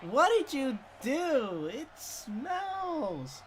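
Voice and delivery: high, drawn-out southern lady, appalled; older southern woman. Retold or imitated. Older teacher comes out of the bathroom, appalled southern accent. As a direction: wail it slowly in a hammed-up southern accent. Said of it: "a southern accent".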